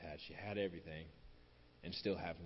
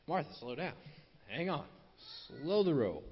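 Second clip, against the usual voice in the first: higher-pitched, playful voicing of Jesus reining someone in; acted "hold your horses" interjection, pitched up; 6 semitones higher, 4 dB louder than usual. Preacher voices Jesus telling busy Martha to ease up, playfully exasperated.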